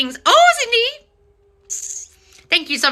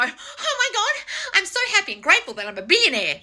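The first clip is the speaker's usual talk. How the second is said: mock grateful gushing; 9 semitones higher than usual. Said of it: "rich people don't go".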